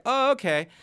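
high mock-surprised oh okay; mock surprise. Storyteller reacting to the cat washing gloves, mock surprise. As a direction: act lightly taken aback. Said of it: "And I was like".

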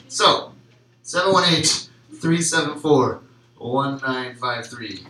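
upbeat, slow announcer read of number; infomercial voice. Acted. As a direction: sell it like a TV ad. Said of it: "I feel like I have a good infomercial voice".

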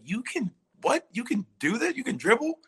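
high-pitched mock amazement; incredulous teammates. Teammates stunned that he can dribble in pickup, mock incredulous.

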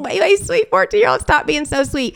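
put-on high, rushed emotional gushing at a sweet child; emotional, choked up. Mother overwhelmed by her fourteen-year-old son's offer, high emotional gushing. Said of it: "just gonna get emotional".

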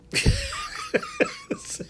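high, wheezy laugh. Real, not acted. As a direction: laugh helplessly, high and breathless. Laughing at the tweet's absurdity.

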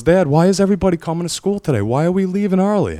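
put-on high, quick child's voice asking questions; be the puzzled young daughter; imitating his daughter asking why they leave early; curious little girl. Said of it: "she goes".

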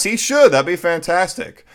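ordinary delivery

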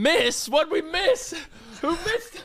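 high, alarmed, confused questions; panicked grandpa mishearing. Acted. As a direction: ask in a high panic what went wrong.

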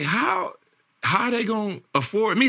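high, incredulous how; disbelieving question. Retold or imitated. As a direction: ask it like you can't believe it. Retold disbelief at the trade.

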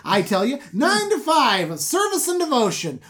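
high, loud impassioned rant; ranting Jacob. Retold or imitated. Jacob ranting at Laban about his hard work, loud. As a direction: build the grievance to a shout.

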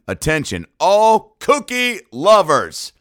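big, slow, high mock announcer call. Comic announcing to all cookie lovers, hammed-up announcer. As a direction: boom it out like a stadium announcer.

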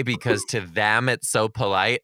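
ordinary talk

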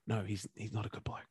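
whispered aside, barely voiced. Real, not acted. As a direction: lean in and whisper it like a secret. Aside about the coach.

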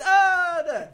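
loud, high, drawn-out wail; mock dismay. Bandmate joking about lawsuits, wailing in mock dismay. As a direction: cry out in pretend horror.

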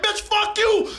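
high-pitched mock outburst; imitated angry retort. Mother defying the householder, acted angry high retort. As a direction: act out a mother's furious comeback.

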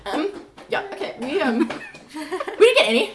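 high, halting retold confession; awkward admission. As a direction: stammer through embarrassing news with a laugh. Retold awkward bad-news phone call.

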